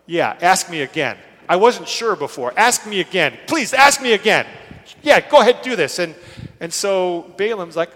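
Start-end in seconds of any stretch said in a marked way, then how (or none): 0.1-6.0 loud, exaggerated sarcastic retort; mock sarcasm. Preacher voicing the Lord's reply to repeated asking, sarcastic. The Lord mockingly retold as relenting.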